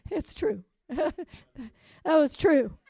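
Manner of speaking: high, giggly insistence; laughing. Real, not acted. Assuring the church her story is true, laughing. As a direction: insist through bubbling laughter.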